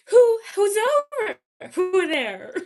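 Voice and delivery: high, loud mid-Atlantic with WH on everything; mid-Atlantic girl. Shown as a demonstration. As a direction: spoof a mid-Atlantic accent, puffing out each WH. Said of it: "girl who just adds, like, the W H sound to, like, everything".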